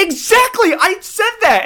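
loud, high burst of vindication; excited agreement. Real, not acted. Co-host confirms the accent change, loudly vindicated. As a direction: pounce on the point, loud and high.

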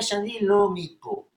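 Hebrew in a foreign gringo accent. Shown as a demonstration. Teacher demonstrating a foreign accent in Hebrew to the host. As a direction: speak Hebrew like a gringo tourist. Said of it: "אני נשמעתי גרינגו".

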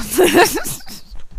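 sudden loud laugh. Real, not acted. Host laughing off her own gloom. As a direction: burst out laughing.

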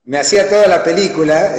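normal talking voice

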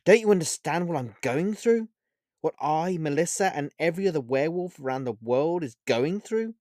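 sad, exasperated plea; frustrated sadness. Acted. Olivia vents her loss to Vega, sad and frustrated. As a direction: vent your grief at him. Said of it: "sadness and frustration".

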